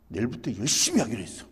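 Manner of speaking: lightly voiced son, quick delivery; resolute son. Son promising dad he will work hard, determined.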